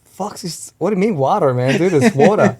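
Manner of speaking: high, loud incredulous mock outburst; mock indignant self. He reacts to nephew's American accent, mock baffled. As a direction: act out his own baffled reaction. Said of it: "I'm like".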